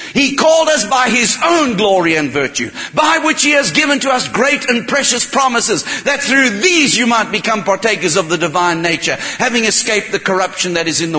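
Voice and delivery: soaring sermon emphasis; preaching fervently. Real, not acted. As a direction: lift the promise up. Preaching at full force.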